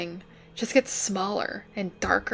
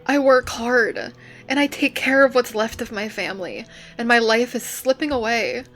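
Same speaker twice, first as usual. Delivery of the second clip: loud, higher, frustrated venting; anguished outburst; 4 semitones higher, 3 dB louder than usual. Acted. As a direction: spill out years of frustration. Emotional outburst about being trapped.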